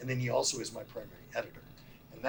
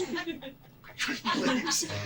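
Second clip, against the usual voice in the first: laughing quote; 14 semitones higher than usual. Retold or imitated. Gendarme's answer, retold through laughter.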